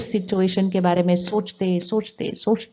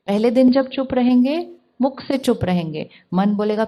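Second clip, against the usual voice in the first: ordinary delivery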